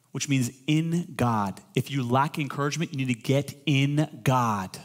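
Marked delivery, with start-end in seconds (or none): none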